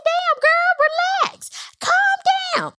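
exaggerated high-voiced reaction; high-pitched exclamation. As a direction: squeal it at her like a stunned onlooker. Recapping own reaction to Wendy.

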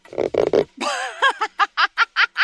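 high, helpless cackling; uncontrollable laughing. Acted. Juliet caught in a laughing fit, uncontrollable laughing. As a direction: laugh till your stomach hurts. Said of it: "stomach muscles are contracting uncontrollably".

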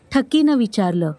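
ordinary delivery, nothing marked

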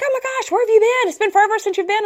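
high, fast, gushing welcome; excited greeting. Retold or imitated. Friends at the shelter greet her after a long absence, high excited voice. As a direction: squeal it out like long-lost friends. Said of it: "they're like".

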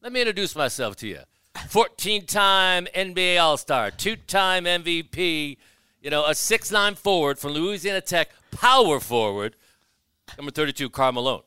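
mock arena announcer voice; announcer-style self-intro. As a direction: boom it out like a PA introduction. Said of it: "He goes".

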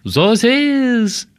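very high, drawn-out mock French; supposed actual French. Co-host giving a little taste of French, mock French. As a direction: stretch "Sans Liege" out high as faux French. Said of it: "that's actual French".

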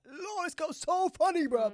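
high, loud delighted gushing; giddy late-night gush. Retold or imitated. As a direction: gush about her like at one a.m. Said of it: "I was like, one A M".

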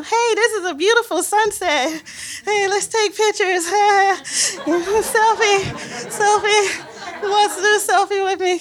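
overly bright pretend calm; fake cheerful. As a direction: chirp about selfies to dodge snorkeling. Faking cool to avoid water.

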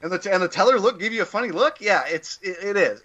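mock questioning repeat; Jeff Garland impression. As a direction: keep the parody banter going, high. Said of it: "the Jeff Garland".

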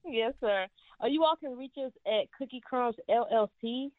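ordinary talk, nothing marked

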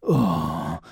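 low mock groan, drawn out; lazy effort groan. Imitating Morocco tearing his shirt, low weary groan. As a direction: groan like a tired wrestler heaving off a shirt. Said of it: "That's what that looked like here".

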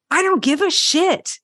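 drawn-out, high defiant snap; defiant retort. Retold or imitated. Comic shrugging off the headliner's jab, defiantly. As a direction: throw it back at him, unbothered. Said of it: "I was like".

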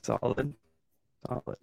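choppy robotic dropout, bad connection; glitchy jumping pitch. Real, not acted. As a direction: voice breaking up over laggy internet. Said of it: "You sound like a robot right now".

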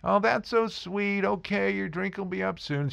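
high, sugary put-on flirt voice; exaggerated stripper voice. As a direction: ham up a syrupy stripper sweet-talking a customer. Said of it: "I'm exaggerating it like crazy".

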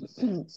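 rough clearing of the throat. Real, not acted. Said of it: "Excuse me".

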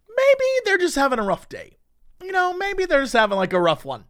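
high-pitched, gently excusing; charitable reasoning. Acted. Excusing awful people to oneself, charitable high voice. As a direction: say it high, giving benefit of the doubt.

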